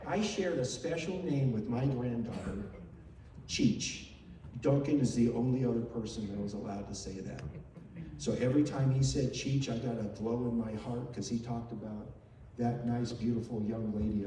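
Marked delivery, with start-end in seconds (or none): none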